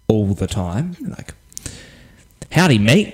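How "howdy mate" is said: a Texan howdy, pitched up; mock American accent. Australian mates joking about American tourists, mock American accent. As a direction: play a cowboy greeting. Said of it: "I'm trying to do an American accent".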